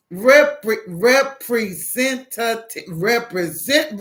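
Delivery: "representative" slowly, syllable by syllable; over-enunciated. Retold or imitated. Caller to a phone system that misunderstands, slow and over-enunciated. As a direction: sound out "representative" for a dense listener.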